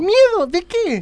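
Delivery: high, incredulous question; surprised. Acted. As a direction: ask as if the idea were absurd. Puzzled by friend's question.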